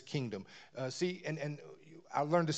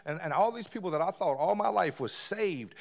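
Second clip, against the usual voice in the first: usual speaking voice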